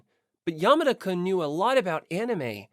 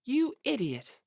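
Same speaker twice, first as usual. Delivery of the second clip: cold; 5 semitones higher than usual. Acted. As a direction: drop it on him like ice. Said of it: "two cold words".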